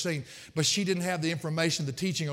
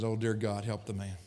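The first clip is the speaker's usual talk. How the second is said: despairing colleague; 7 semitones lower, 4 dB quieter than usual. Retold or imitated. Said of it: "hangs his head and says".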